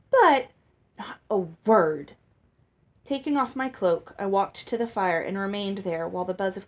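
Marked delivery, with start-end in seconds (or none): 0.1-0.5 startled protest. A narrator's reading. Hattie protesting, cut off. 1.0-2.2 curt command. A narrator's reading. Ella cutting Hattie off.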